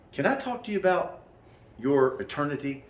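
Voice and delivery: high, sugary mock pitch of a street evangelist; mock evangelist asking about eternity; mock preacher voice. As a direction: put on the sweet door-knocker voice.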